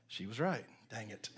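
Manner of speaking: low, soft grumble; grudging admission. Retold or imitated. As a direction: concede it under your breath, quietly. Grudging admission to his wife.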